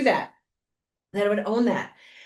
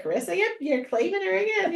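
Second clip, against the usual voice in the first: teasing host; 10 semitones higher than usual. Retold or imitated. Host's teasing reply imitated.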